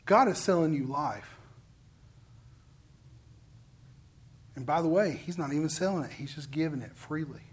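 tender preacher hush; soft and tender. Acted. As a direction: gently offer it, almost hushed. Preacher softening on God's offer.

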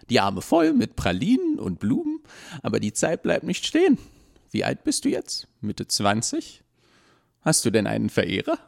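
high, gushing busybody voice; chatty old woman. Acted. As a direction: prattle on like a nosy old lady.

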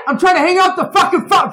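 high, very loud furious yell; enraged screaming. Retold or imitated. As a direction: scream it in exasperation at a caller. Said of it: "this is how it descends".

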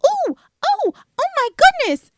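very high, breathless gushing princess voice. Retold or imitated. Podcast host mimics the actress's princess, very high gushing squeal. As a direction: squeal it like a cartoon princess overwhelmed with delight. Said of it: "Everything is like".

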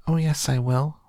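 high, tiny squeak; Mike. A narrator's reading. Shrunken boy contradicting his mother, tiny squeak. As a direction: squeak back defiantly in a small voice. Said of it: "squeaked the tiny voice of Mike T V".

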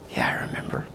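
unvoiced whisper of remembering; awed whisper. Young man whispering his answer back to God. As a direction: breathe it out in awe.

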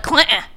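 "Clinton" with the T swallowed; rolled-in pronunciation. Retold or imitated. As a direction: mockingly slur over the T in "Clinton". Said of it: "Stop rolling it like".

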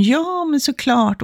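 acted obliging reply, high and drawn out; eager agreeing. Codependent walker saying yes to neighbour's coffee, eagerly agreeing. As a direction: agree readily, stretching it high. Acted over-willing acceptance of invitation.

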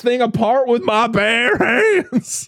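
high, slow hammed-up threat; farmer voice. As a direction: play the raging old farmer. Acting the farmer's threat again.

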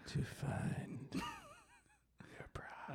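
very slow, drawn-out whisper; hammed-up creep whispering; creepy whisper. Host playing up the creepy guy remark, slow whisper. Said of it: "like, a creepy guy would say".

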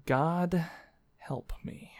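weary unvoiced whisper; whispered plea. Real, not acted. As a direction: beg under your breath.

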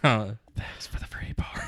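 slow, intense stage whisper; movie-quote whisper. Acted. Quoting the film's parting jab to friends, slow exaggerated whisper. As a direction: whisper it slowly, right in someone's face. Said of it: "it says whispering".

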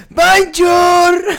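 high, loud, sung-out greeting; silly loud greeting. Acted. Comic milking a laughing crowd, silly shouted greeting. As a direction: hoot it across the room.

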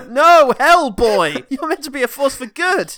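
hammed-up high, loud plea; Ian Lovejoy shouting. Film character begging Hellboy to be good, overwrought shout. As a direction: bellow it melodramatically, dragging out the first words. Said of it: "Ian Lovejoy shows up and says".

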